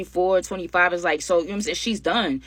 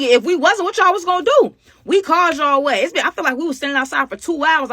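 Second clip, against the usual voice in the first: loud, high-pitched exasperation; indignant complaint; 9 semitones higher, 6 dB louder than usual. Retold or imitated. Woman to police who came late, indignant complaint. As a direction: tell off the latecomers in outrage.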